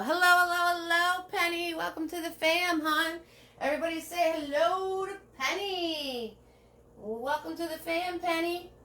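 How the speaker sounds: high, gushing hello held all through; sing-song welcome. Real, not acted. Host welcomes Penny into the chat family, high sing-song greeting. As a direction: welcome the newcomer with delight.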